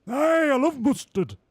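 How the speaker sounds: high, loud, slow cartoonish driver; cheerful driver voice. Streamer voicing the driver to the team boss, high and loud. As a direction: chirp it out like a goofy racing driver.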